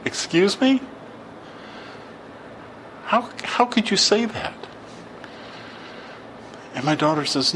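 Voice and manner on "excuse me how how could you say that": high-pitched, incredulous questioning; retold indignant "how dare they" reaction; shocked disbelief. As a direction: voice leaps up in appalled disbelief. Retold shocked reaction to priest's answer.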